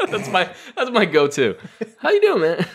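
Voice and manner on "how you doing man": much higher, casual "how you doing"; his signature nervous greeting; high breezy greeting. Retold or imitated. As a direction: do the fake-chill go-to hello.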